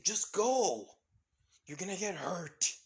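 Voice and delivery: high, loud acted warning; urgent shout. A narrator's reading. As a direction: shout her away from danger. Trapped boy shouts at her to leave.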